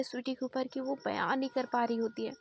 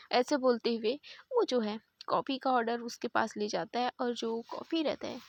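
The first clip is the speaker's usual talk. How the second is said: ordinary delivery, nothing marked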